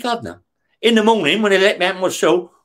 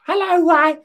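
much higher, sing-song camp voice; camp greeting; 11 semitones higher than usual. Retold or imitated. Terry greeting Ray at his cell door, high camp voice. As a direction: greet him like a flamboyant neighbour.

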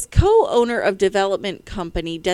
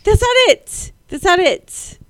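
higher, louder denial; loud insistence; 10 semitones higher, 8 dB louder than usual. Real, not acted. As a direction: insist it loudly. Insisting loudly.

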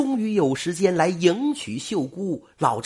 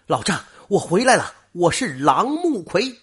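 calling out; 5 semitones higher, 4 dB louder than usual. A narrator's reading. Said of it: "叫道".

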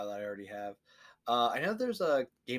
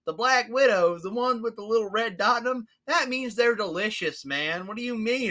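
much higher, louder gleeful dopey voice; dopey excited; 12 semitones higher, 7 dB louder than usual. Retold or imitated. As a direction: relish it like a happy idiot. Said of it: "And he's like".

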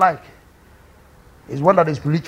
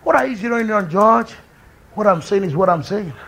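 ordinary delivery

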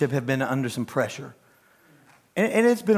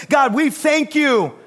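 preaching prayer; 10 semitones higher, 5 dB louder than usual. Acted.

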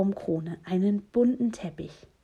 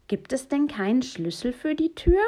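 higher, raised questioning voice; raised voice, climbing to the end; louder question; 6 semitones higher, 4 dB louder than usual. A narrator's reading. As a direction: ask it up and out through a closed door. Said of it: "und fragt dann lauter".

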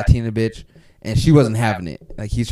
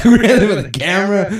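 high, fast and louder, laughing into it; hyped-up nervous bravado; excited; 8 semitones higher, 5 dB louder, faster than usual. Retold or imitated. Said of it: "repeats it".

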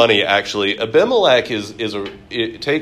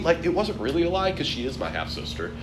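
sheepish excuse; 5 semitones higher, 8 dB quieter, faster than usual. Retold or imitated. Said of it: "And Abraham says".

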